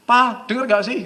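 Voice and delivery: wife's impatient call, high and loud; loud, high, acted nagging shout. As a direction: raise your voice at a husband who won't listen. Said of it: "teriakin nggak denger".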